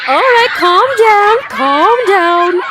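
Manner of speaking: teacher calling order over laughter; hushing the class. A narrator's reading. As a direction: settle the giggling class. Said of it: "hushed Missus Wimpy Dimple".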